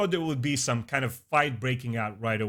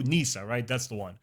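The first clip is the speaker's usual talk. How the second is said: normal talking voice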